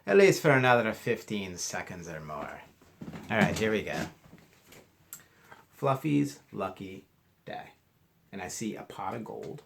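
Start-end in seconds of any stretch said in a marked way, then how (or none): 5.7-7.7 slow, lifted title read. A narrator's reading. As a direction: announce the title slowly. Reading the book title.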